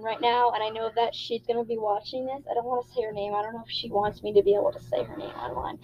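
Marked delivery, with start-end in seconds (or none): 3.3-5.8 whispering. Real, not acted. Aside about friend.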